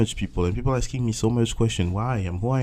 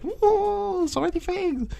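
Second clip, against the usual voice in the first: much higher, squeaky cartoon voice for his brain; overwhelmed whoa; slower than usual. His own brain overwhelmed, squeaking high. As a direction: play the brain as a tiny squeaking voice.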